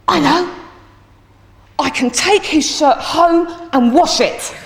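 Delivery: put-on high, slow, emphatic child's announcement; excited bright idea. Pupil suddenly offers teacher a solution, excited. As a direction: imitate a boy proudly finding the answer.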